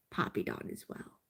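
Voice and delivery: barely voiced soothing repeat; whispered mantra. Real, not acted. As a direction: whisper it under your breath to calm down.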